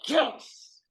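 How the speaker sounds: very high triumphant yes; gleeful yes. Retold or imitated. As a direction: cheer it like you just won. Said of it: "I'm like".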